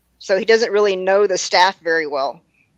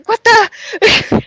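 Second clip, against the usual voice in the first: stunned disbelief; 12 semitones higher, 4 dB louder than usual. Retold or imitated. Narrator's stunned reaction to Margaret.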